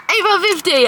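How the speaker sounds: the price hits and she yelps it; half-joking disbelief at the cost; shocked at price. Real, not acted. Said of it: "Holy shit!".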